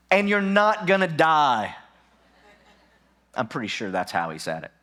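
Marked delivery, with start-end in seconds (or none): 0.1-1.7 louder, higher, hammed-up divine declaration; God's emphatic voice. God assuring the terrified Gideon, loud and hammy. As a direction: proclaim it big and playful. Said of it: "I think he said it just like this".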